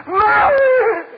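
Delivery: husband screaming for the wife he has just killed; anguished scream. Acted. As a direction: scream her name in despair. Realizes she is dead.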